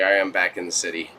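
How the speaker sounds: ordinary delivery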